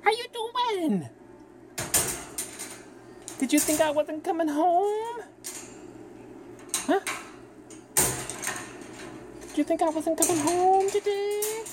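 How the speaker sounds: high, slow sing-song pet voice. Real, not acted. Greeting the bird coming home, high slow baby talk.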